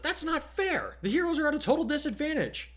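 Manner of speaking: put-on high, indignant girl's voice; Mina protesting. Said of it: "Mina frowned and said".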